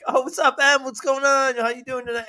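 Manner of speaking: high-pitched chummy hello; eager, high-voiced mock friendliness; excited greeting. Acts out greeting the bot excitedly.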